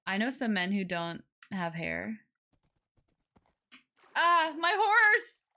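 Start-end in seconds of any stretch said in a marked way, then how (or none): 4.2-5.5 loud, high, drawn-out cry of alarm. Real, not acted. Player spotting horse in water, loud alarmed cry. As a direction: cry out as the horse goes under.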